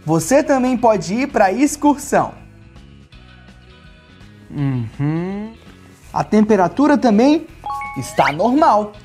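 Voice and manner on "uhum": ordinary delivery, nothing marked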